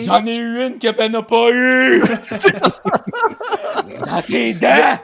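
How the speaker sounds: loud triumphant crowing through laughter; gleeful gloating shout. Real, not acted. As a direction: yell it out, laughing in victory. Gloats at getting an answer.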